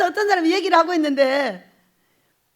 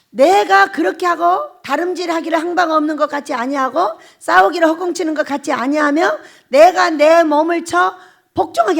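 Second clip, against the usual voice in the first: preaching the verse; 6 dB louder than usual. Acted. Preacher quoting scripture forcefully.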